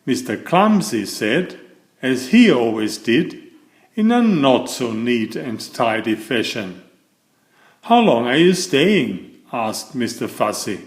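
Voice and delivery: lively read-aloud for children, voicing each character; storybook narration. A narrator's reading. Reading a Mr Men book to young viewers, animated storytelling. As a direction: tell it like a bedtime picture book.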